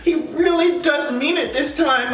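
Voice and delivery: high-pitched mock naive hope; mocking, high, wishful gushing; imagined hopeful girlfriend. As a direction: imitate a lovestruck woman believing every word. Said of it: "I know that she was somewhere".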